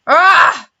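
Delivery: loud villain's angry roar. A narrator's reading. Count Olaf roars as his plan fails, loud angry roar. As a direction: bellow in rage. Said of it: "Count Olaf roared".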